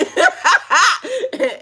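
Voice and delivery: high cackle falling away; high laugh. Real, not acted. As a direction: cackle high, then let it fade. Laughing at her own joke.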